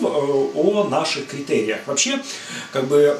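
ordinary talk

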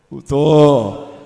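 low, loud, falling "betul"; drawn-out affirmation. Real, not acted. Preacher checking the crowd agrees, low drawn-out affirmation. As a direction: answer low and firm, stretching it out. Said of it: "Ada teriakan keras".

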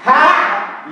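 high punchy Ha!; hammed-up TV host shout; Sérgio Malandro yell. Shown as a demonstration. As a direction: bark the catchphrase like a TV host. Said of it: "Esse é o Sérgio Malandro".

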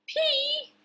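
tiny, very high squeak of disbelief; high-pitched storybook shock; shocked vegetables. Acted. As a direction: squeal the word in alarm. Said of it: "They went".

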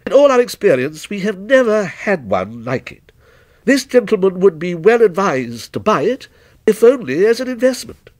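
keen endorsement, pitched high to the end; shop witness, admiring. Acted. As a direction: urge the sale as a loyal employee. Employee vouching for the vase.

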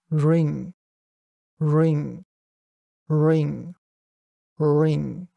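"ring" with a careful English R, tongue position shifting each take; isolated "ring", R sounded without tongue contact; four R methods. Shown as a demonstration. Teacher reading words for comparison, four English R methods. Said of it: "全四つの方法でここに用意した単語を読んで".

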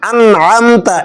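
normal talking voice

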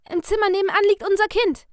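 high, loud panicked begging; young father pleading at gunpoint; desperate pleading. Acted. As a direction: beg for your life, voice climbing. Captive man pleads for his life.

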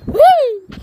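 high loud squeal; excited whee. Real, not acted. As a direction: shout it with joy mid-jump. Jump on trampoline.

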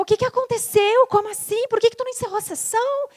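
high, loud demanding questions; indignant mother. Retold or imitated. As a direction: fire the questions at her like an upset mother. Mother confronting her angrily.

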